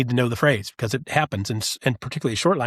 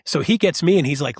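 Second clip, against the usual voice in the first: ordinary delivery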